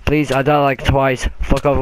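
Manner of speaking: usual speaking voice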